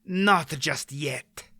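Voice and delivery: slow, cold interruption; harsh voice. A narrator's reading. The Grolim interrupts the departing party, harsh. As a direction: cut in with menace. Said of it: "a harsh voice interrupted".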